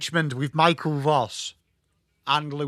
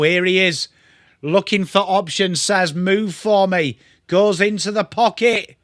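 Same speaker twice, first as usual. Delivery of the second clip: louder footy call, a bit higher; lively mock commentary; 4 semitones higher, 6 dB louder than usual. Streamer calls a forward entry for chat, louder commentary. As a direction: push the call up as the ball goes forward.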